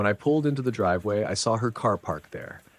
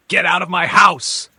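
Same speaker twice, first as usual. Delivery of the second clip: screamed at full force, high and loud; furious scream; 12 semitones higher, 13 dB louder than usual. Retold or imitated. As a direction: scream her out of the house. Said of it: "I straight up screamed".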